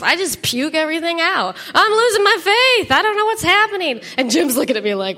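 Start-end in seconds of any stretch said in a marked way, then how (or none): 1.7-4.0 high, loud spilling-over cry; desperate outburst. Retold or imitated. Wife pouring out her crisis to friends, loud. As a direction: blurt it all out at once.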